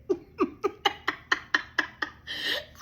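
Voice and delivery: helpless high-pitched cackle; high wheezing laugh. Real, not acted. Laughing at her own stupid shit, helpless laughter. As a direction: break up laughing at yourself.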